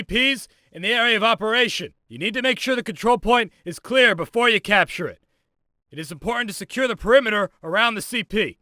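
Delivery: high, projected instructor's call; calling out orders. Acted. Instructor directs trainee to capture the car, calling out. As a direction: call the drill out across the yard.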